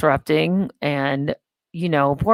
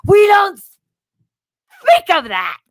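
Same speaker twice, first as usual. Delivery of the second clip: high, slow, loud pompous decree; mock Jesus voice; 14 semitones higher, 7 dB louder, much slower than usual. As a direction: boom it out slowly as Jesus. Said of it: "Do you think Jesus would be like".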